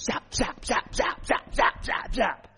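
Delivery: sharp rapid-fire zap sound effects; punchy, repeated zapping noises; imitated zaps. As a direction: mimic lightning bolts striking one after another. Imitates lightning bolts striking.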